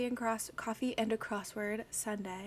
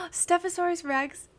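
a loud, high cheer of a name; excited shout; 7 semitones higher, 7 dB louder than usual. Real, not acted. Streamer cheering a friend's name in chat, loud and high. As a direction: cry it out in delight.

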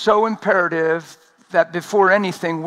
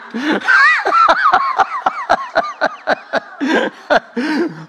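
loud, high burst of laughter; real laughter at his own joke; big laugh; 3 dB louder than usual. Real, not acted. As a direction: let the laugh explode.

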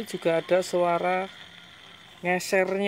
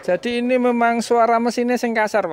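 higher and louder explanation; raised voice; 6 semitones higher, 5 dB louder than usual. Real, not acted. Mechanic telling the owner the engine sounds rough, in a raised voice. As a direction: call out the finding. Still loud after engine test.

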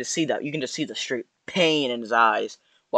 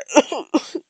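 sudden high-pitched burst of laughter; 13 semitones higher than usual. Real, not acted. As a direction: crack up at the joke. Laughing at his own joke.

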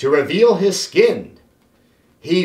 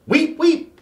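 high squeaky weep weep; guinea pig squeak; 9 semitones higher than usual. A narrator's reading. Guinea pig racing round the cage, squeaking. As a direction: squeak while running circles. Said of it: "he squeaked".

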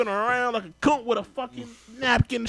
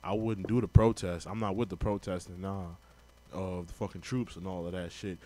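much lower, mumbled mock Jerry Rice; sneering imitation of the football legend, pitched way down; 12 semitones lower, 10 dB quieter than usual. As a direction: put on a low dopey voice to mock him. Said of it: "He was like".

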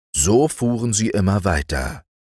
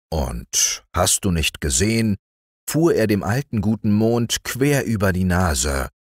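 usual speaking voice

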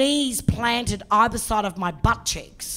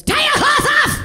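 high, loud barked order; bossy masseuse shout; 7 dB louder than usual. Retold or imitated. Masseuse ordering her customer to strip, loud high shout. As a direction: bark it like a pushy masseuse. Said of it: "gets in really close and goes".

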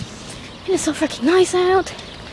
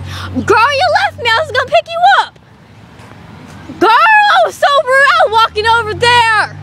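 high, loud complaint; loud scolding; 11 semitones higher, 4 dB louder than usual. Real, not acted. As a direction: yell at her for leaving. Scolding mom for leaving.

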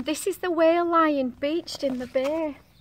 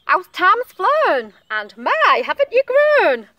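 high, loud, drawn-out greeting; exclaiming snail flock; 7 semitones higher, 12 dB louder, slower than usual. A narrator's reading. Flock greets the returning snail, loud high exclamation. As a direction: exclaim it loudly with swooping high pitch. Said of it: "Back to the dock and the flock on the rock said".

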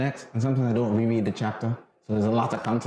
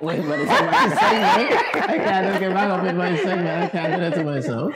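laughing through the words; 7 semitones higher, 4 dB louder than usual. Real, not acted. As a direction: chuckle while explaining, high and loud. Laughing at own screen beating.

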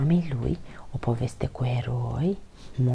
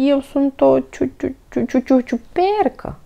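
high, loud stammer, slowing on ciupercă; frightened stuttering squeak; 11 semitones higher, 6 dB louder than usual. A narrator's reading. Toni pretends to the badger to be a mushroom, terrified stutter. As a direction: stutter ciu- ciu- in panic. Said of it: "chițăi Toni".

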